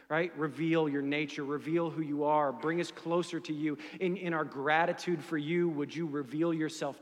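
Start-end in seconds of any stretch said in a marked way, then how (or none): none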